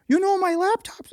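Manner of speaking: high-pitched mimicry of an odd Wisconsin-like accent; strange Wisconsin-like accent. Customer calling about her laptop, strange Wisconsin-like accent. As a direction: put on a high, twangy Wisconsin voice. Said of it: "she has that accent".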